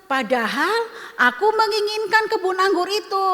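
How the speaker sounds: petulant royal whine to his queen; whiny sulking king. Acted. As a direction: play the pouting king begging for sympathy. Sulking king complains to wife.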